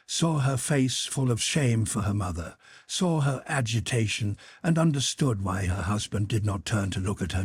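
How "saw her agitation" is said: ordinary delivery, nothing marked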